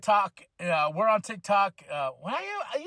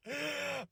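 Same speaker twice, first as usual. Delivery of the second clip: high laugh; 8 semitones higher, 10 dB quieter than usual. Real, not acted. Laughs after promo.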